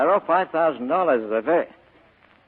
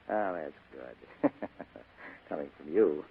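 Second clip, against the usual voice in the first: slow, laughing through the words; sardonic laugh; 7 semitones lower, 9 dB quieter, slower than usual. Acted. Spade laughs off her offer to bid for his loyalty, slow and amused. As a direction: chuckle it out, amused and unconvinced.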